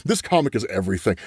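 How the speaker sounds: ordinary delivery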